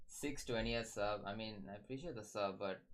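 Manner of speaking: usual speaking voice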